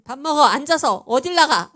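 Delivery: 크게 버럭 부르는 엄마 목소리; 나가려는 자식을 크게 붙잡아 앉혀; 엄마의 호통. Retold or imitated. 엄마 흉내로 다시 호통. (엄마가 자식을 불러 앉히듯 크게 호통)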